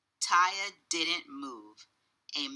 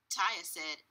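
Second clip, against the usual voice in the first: ordinary delivery, nothing marked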